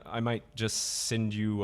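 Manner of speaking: normal talking voice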